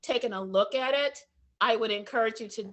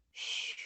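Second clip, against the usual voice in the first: soft whispered phew of relief; relieved exhale; 12 dB quieter than usual. Retold or imitated. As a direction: let the breath out in relief. Relief after being wound up.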